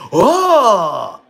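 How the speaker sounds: high, loud hammed-up crowd "Oh!"; mock crowd gasp. Mocking soccer fans gasping at nothing, hammed-up and loud. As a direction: mimic fans gasping at a near miss.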